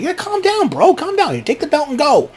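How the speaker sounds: high mock soothing voice; referee calming him. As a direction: talk him down in a high falsetto. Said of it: "Bryce Remsburg's like".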